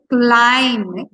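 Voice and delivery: CLIMATE with CLI- stretched long and loud; exaggerated, drawn-out stress on the start of climate; over-stressed first syllable. Shown as a demonstration. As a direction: drag out and punch the CLI in climate. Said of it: "you don't have to say".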